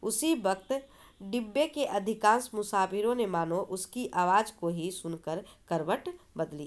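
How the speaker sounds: ordinary delivery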